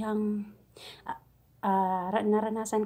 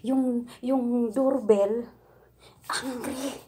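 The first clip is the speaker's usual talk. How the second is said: imitating the madam; 2 semitones higher, 6 dB louder, much slower than usual. Maid mimics her Saudi employer's slow broken English order. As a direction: stretch out each word like the Saudi boss. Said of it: "Ganon ang pagkasabi niya".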